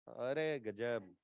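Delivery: slowly savoured wonder; slow, soft amazement. Real, not acted. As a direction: stretch अरे गजब out slowly and let it fall softly. Teacher repeats the same remark.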